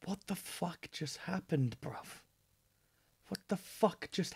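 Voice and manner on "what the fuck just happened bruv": quiet, far-off disbelief; stunned mutter. Real, not acted. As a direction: mutter it under your breath, stunned. Stunned reaction after album ends.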